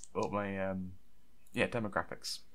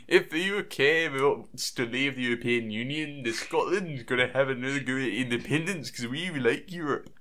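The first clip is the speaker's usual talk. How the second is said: put-on Scottish accent, higher and lively; 3 semitones higher than usual. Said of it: "I've got to do a Scottish accent".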